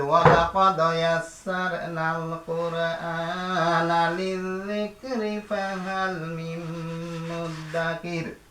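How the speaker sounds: slow melodic Quranic recitation; slow, sung Arabic recitation. Shown as a demonstration. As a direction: recite the verse slowly with devotion.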